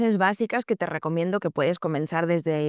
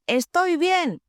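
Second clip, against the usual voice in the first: "Estoy bien" exclamado, alto y con énfasis en "bien"; exclamación; 9 semitones higher, slower than usual. Shown as a demonstration. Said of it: "solo cambia la entonación". (exclamación enfática y aguda)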